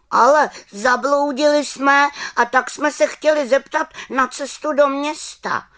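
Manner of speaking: put-on high, loud child's voice; boy asking the way. A narrator's reading. Lost boy asks the witch for the way to town, high child voice. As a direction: ask for directions like a lost little boy. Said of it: "řekl chlapec".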